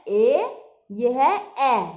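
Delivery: ए said loud and clear as in bad; ए punched as a short open vowel. Shown as a demonstration. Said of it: "इसको क्या बोलते हैं".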